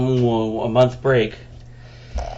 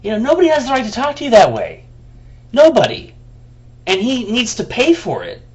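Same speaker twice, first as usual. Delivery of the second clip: real outrage, pitched high; indignant; 9 semitones higher than usual. Real, not acted. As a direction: defend him fiercely. Indignant defense of friend.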